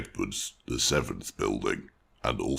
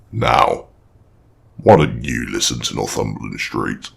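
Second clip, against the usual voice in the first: loud booming archway voice; talking stone arch; 12 dB louder than usual. Acted.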